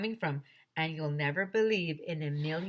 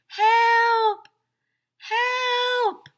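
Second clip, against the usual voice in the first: high, distant muffled calls; muffled cry for help; 11 dB louder than usual. A narrator's reading. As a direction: cry out from far away. Trapped man calling out.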